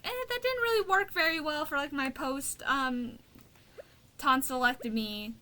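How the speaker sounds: high, hesitant put-on voice; hesitant complaint. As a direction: squeak out the doubt timidly.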